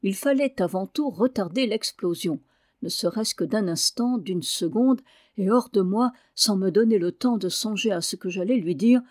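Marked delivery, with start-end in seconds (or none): none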